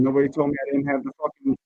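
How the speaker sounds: ordinary talk, nothing marked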